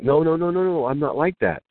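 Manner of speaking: high, rapid-fire mock denial; retold protest. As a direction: imitate a monk modestly waving off praise. Monk protests again in story.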